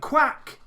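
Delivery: sudden high shouted quack. Real, not acted. Gamer blurts a duck noise mid-match, shouted quack. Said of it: "why I've just shouted quack there".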